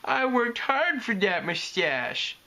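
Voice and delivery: put-on high, slow little-kid pleading; pleading whine. A narrator's reading. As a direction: beg with puppy-dog pitifulness. Young dragon pleads with puppy eyes.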